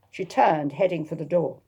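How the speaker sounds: plain reading voice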